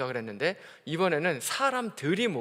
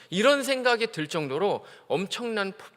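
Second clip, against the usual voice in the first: ordinary delivery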